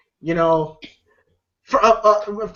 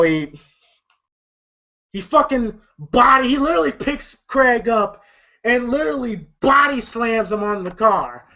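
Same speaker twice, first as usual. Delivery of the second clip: ordinary delivery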